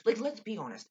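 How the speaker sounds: ordinary talk, nothing marked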